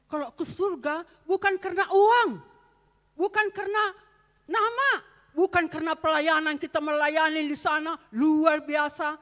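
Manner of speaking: loud, high preaching shout; fiery preaching. Acted. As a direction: preach it out to the congregation. Preaching at full force. (khotbah berapi-api dengan suara keras)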